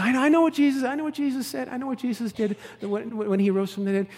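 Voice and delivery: put-on high, eager little-girl voice; lightly voiced eager little girl. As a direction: play an eager child. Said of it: "she says".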